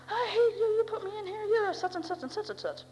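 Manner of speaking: high, tearful, furious accusation; crying angry teen. Retold or imitated. Sister calling mom from rehab, crying and furious. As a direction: shriek it through tears. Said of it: "call Mom all the time and say".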